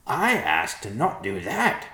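high, loud, slow bird voice; objecting vulture. A narrator's reading. Vulture refuses the plea.

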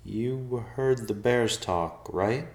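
slow, high-pitched disbelief; dazed, drawn-out question; stunned. A narrator's reading. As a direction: check warily that you weren't imagining it. Stunned man checks what he heard.

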